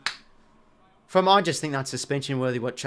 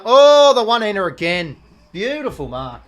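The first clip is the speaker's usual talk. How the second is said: much higher, loud burst of delight; thrilled exclamation; 6 semitones higher, 13 dB louder than usual. Real, not acted. Fan cheering a one-handed mark, loud and thrilled. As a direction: gasp out in joy at the mark.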